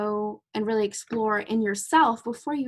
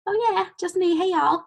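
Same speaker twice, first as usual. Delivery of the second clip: high, louder chirpy Southern hi; mock-perky Southern greeting to a patient; Southern hello; 8 semitones higher, 4 dB louder than usual. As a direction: play up a cheery Southern twang on y'all. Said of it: "And I'm like".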